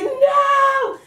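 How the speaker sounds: loud, very high yelled refusal; retold alarmed yell. Wife as husband grabs her sore leg, alarmed yell. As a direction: yell it out sharply to stop him.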